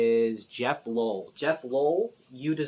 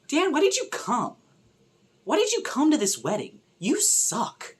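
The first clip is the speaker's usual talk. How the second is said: exasperated scolding; 10 semitones higher, 4 dB louder than usual. Real, not acted. Scolding character on screen.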